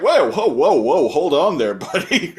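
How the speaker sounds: high, laughing backpedal; mock alarm, trying to calm a ranter; amused, flustered whoas; Jacob. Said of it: "And Jacob's like".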